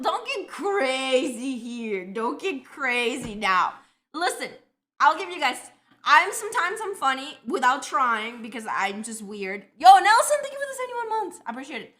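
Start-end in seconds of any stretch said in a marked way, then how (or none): 0.0-3.7 slow, stretched-out words; half speed, teasing caution; drawn-out mock warning. Real, not acted. 9.8-11.4 loud, high burst of thanks; sudden high-pitched hype for a supporter; excited shout-out. Real, not acted. Calling out thanks to a subscriber.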